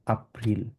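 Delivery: এপ্রিল said as "ah-pril". Shown as a demonstration. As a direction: start এপ্রিল with an open AH vowel. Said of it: "এটাকে হচ্ছে আ উচ্চারণ করি". (এপ্রিল-কে "আপ্রিল")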